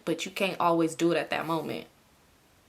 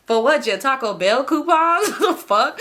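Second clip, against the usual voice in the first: sarcastic jab; 5 semitones higher, 5 dB louder, slower than usual. Real, not acted.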